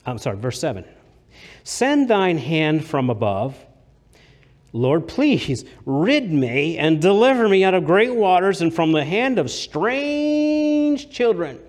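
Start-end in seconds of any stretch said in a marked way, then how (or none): 1.6-11.6 slow, building to a loud, high cry; fervent scripture reading. Acted. Preacher reading the psalm aloud, building to a loud plea.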